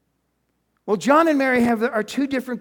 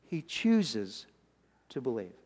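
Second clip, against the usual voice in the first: hushed repeat; 8 semitones lower, 8 dB quieter, slower than usual. Acted. Repeated line, dropped to a hush.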